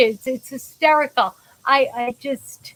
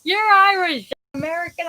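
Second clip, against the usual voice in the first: excited locals; 6 semitones higher than usual. Retold or imitated. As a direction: cheer as if you've found a long-lost cousin. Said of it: "they're like".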